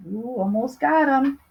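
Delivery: usual speaking voice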